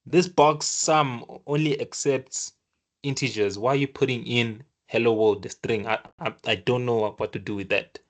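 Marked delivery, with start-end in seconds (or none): none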